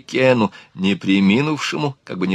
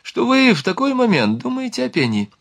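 high-pitched sarcastic jibe; snide sarcasm; 12 semitones higher than usual. A narrator's reading. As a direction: needle them in a much higher pitch. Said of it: "съехидничал Ликен".

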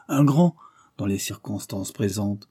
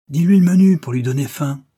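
higher, loud parting shout; calling out; 6 semitones higher, 8 dB louder than usual. A narrator's reading. Comrade shouts to the departing narrator, calling out. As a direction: call it after someone leaving. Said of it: "Il me cria".

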